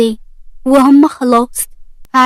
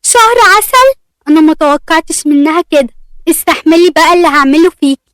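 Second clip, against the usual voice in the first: higher, louder furious outburst; angry; 3 semitones higher, 5 dB louder than usual. Acted. Aseel raging over the honeymoon, higher and louder. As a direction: seethe and threaten, voice up high. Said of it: "أسيل وهي متعصبة".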